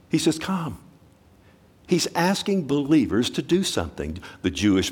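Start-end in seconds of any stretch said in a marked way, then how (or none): 0.4-0.8 soft, tender invitation; pleading. Acted. The Holy Spirit pleading with believers, softly. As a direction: gently plead "Come". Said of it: "is pleading".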